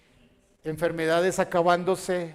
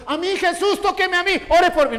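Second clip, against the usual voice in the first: mock pleading crowd; 10 semitones higher, 5 dB louder than usual. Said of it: "no dice que la gente está diciendo".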